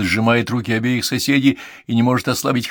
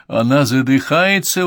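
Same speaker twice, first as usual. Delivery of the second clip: higher, louder, drawn-out alarm; alarmed exclamation; 4 semitones higher, 5 dB louder than usual. A narrator's reading. Conan Doyle sees the medium suffocating, alarmed exclamation. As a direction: exclaim in alarm, stretching the words. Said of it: "воскликнул Конан Дойл".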